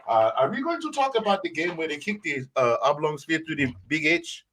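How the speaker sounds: high, prim and proper put-on voice; mock-refined butler voice; put-on posh accent. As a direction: play a stuffy gentleman announcer. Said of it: "I should do the entire show in this accent".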